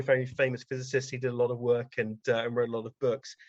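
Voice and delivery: ordinary talk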